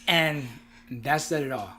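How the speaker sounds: ordinary delivery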